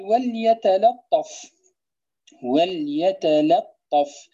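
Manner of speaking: وليتلطف drawn out slowly; slow recitation. Shown as a demonstration. Teacher recites the verse word slowly. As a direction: recite each letter carefully. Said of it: "مقصود الناظم".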